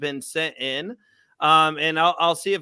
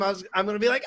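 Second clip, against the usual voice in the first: ordinary talk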